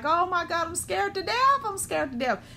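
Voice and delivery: mock-scared emotions crying out; mock panic. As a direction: play the panicking emotions, hammed-up.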